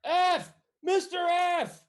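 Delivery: high, drawn-out scream for help. Acted. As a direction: scream the name at full volume as a wounded guard. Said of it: "he just starts screaming".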